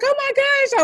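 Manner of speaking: overjoyed oh my gosh; excited gasp. Retold or imitated. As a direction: squeal it out in delight. Retold reaction to the response.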